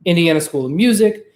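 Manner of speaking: ordinary talk, nothing marked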